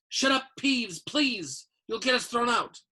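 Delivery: high, pressing plea; urgent plea. A narrator's reading. Pleading with Peeves to hush.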